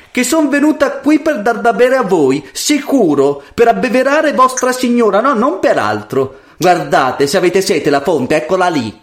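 put-on high-pitched haughty girl. A narrator's reading. Proud daughter refusing water to the lady, haughtily. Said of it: "rispose la superba".

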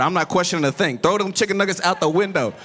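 a hint of dad voice, dropped low; mock obedient husband, low and resigned; lightly voiced dad. Dad to mom after tossing nuggets, lightly low-voiced. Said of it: "He said".

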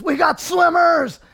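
loud, high, slow alarm call; river guide shouting a warning. Retold or imitated. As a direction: yell the warning over the river. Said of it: "our guide says".

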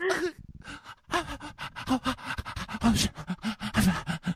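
high, gasping imitated sobs; whisper sobbing. Contestant trying to outcry him, mock whisper sobbing. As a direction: fake breathy whispered sobs. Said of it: "she's, like, whisper sobbing".

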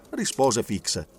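usual speaking voice

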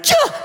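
sudden high yelp of 'Cha!'; yelped cha. Retold or imitated. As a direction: blurt 'Cha!' like a cut-off cry. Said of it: "you looked up and you went".

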